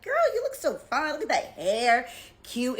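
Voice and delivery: put-on high, loud gushing girlfriend voice; gushing fan. Playing a fan complimenting her, high loud gush. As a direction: squeal a compliment like a hype friend.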